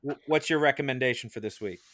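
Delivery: ordinary delivery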